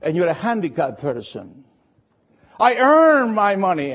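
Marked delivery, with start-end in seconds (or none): 2.6-3.9 truck driver. Retold or imitated. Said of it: "talking to a truck driver".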